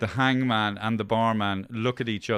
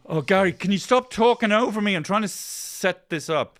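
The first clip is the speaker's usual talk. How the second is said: exasperated; 7 semitones higher, 5 dB louder than usual. Real, not acted. Host scolds guest for interrupting.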